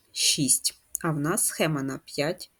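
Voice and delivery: ordinary talk, nothing marked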